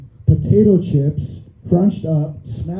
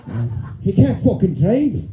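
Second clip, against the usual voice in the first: mock Scottish grumble; lightly Scottish; Scottish accent; 4 semitones lower, 4 dB quieter than usual. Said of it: "I went Scottish with that".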